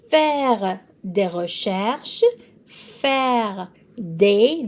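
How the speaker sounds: "faire des recherches" said slowly, "des" like "day"; teacher modelling French vocab slowly; slow French model. Shown as a demonstration. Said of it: "D E S is des".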